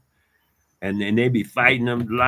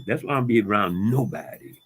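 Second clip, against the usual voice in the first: normal talking voice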